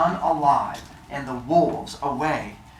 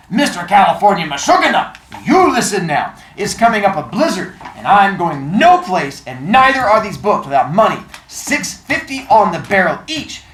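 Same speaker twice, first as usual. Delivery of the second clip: put-on louder New York shopkeeper accent, firm and bossy; thick accent, laying down terms; 5 semitones higher, 5 dB louder than usual. Old bookseller setting his price to the caller, firm thick accent. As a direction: lay down the deal as the old bookseller. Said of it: "Pretty good accent".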